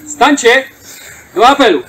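loud ceremonial call to stand. Real, not acted. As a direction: call the fallen to roll call. Ceremonial roll call summons.

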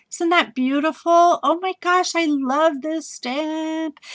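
3 swoon over it like it's treasure; 1 gushing. Real, not acted. 4 crafter gushing to viewers over an Eiffel Tower stamp, high and delighted.

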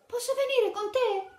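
put-on high, eager child's question; high child voice pleading to tag along; little Tarzan asking. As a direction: ask like an excited little boy. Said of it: "le chiese un giorno".

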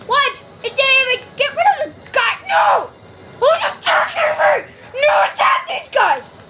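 high-pitched, indignant shouts; exasperated yelling. Real, not acted. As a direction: yell at useless teammates mid-fight. Yelling at game and teammates under attack.

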